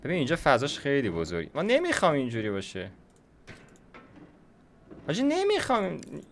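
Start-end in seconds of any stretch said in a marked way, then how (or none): 5.1-6.2 whiny complaint. Real, not acted. Annoyed at the park layout.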